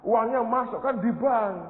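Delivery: mock pushy suggestion, pitched high; voice of ordinary human mind. Pastor voicing the worldly mind, high-pitched mock advice. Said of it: "Otak manusia biasa berkata".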